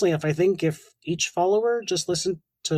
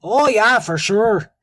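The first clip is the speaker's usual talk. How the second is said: hammed-up Canadian accent, higher and louder; exaggerated Canadian accent; 4 semitones higher, 7 dB louder, slower than usual. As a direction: lay the Canadian accent on thick for a laugh. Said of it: "I sound like such a fucking Canadian".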